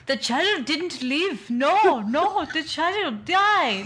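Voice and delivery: slow, high put-on accent, badly faked; hammy Austrian-tinged accent; Angela Lansbury as Schwarzenegger. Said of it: "Angela Lansbury doing her best Arnold Schwarzenegger".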